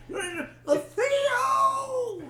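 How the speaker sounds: high crying Theo call; whiny high-pitched shout; Bill Cosby impression. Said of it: "Do Bill Cosby".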